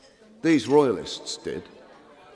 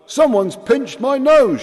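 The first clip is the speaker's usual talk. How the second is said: much higher and louder nasal shout; 13 semitones higher, 9 dB louder than usual. Acted. Ireton complains about his nose, loud shout. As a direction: shout it as if your nose is pinched. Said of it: "shouted Ireton".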